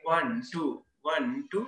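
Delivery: rhythmic ONE-TWO, small gap after each pair; double-count demo. Shown as a demonstration. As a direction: count ONE-TWO in tight, even pairs. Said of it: "दो दो बोल आ गए".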